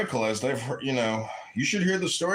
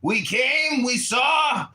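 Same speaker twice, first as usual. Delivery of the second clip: Hillary Clinton impression; 8 semitones higher, 3 dB louder, much slower than usual. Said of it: "just like Hillary Clinton said".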